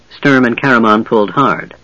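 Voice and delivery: plain reading voice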